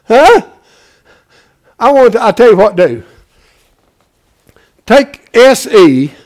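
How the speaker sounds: loud, driving pulpit preaching; rising fervent sermon shout; preaching at full force. Acted. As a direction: hammer it home to the congregation. Preaching the election wordplay.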